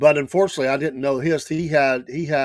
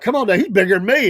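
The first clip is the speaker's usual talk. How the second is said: calling out; 7 semitones higher, 6 dB louder, faster than usual. Retold or imitated. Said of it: "he's like".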